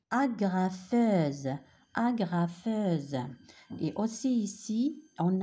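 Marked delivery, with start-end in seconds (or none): 0.1-3.2 Agrafeuse very slow, the gr drawn out; model word. Shown as a demonstration. Teacher drilling Agrafeuse for the class. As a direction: drag Agrafeuse out syllable by syllable. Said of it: "on entend le son G".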